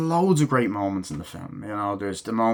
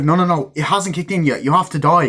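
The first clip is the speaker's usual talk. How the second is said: higher, louder mock alarm; acted urgent protest; 6 semitones higher, 5 dB louder than usual. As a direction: protest insistently as the character, pitched up.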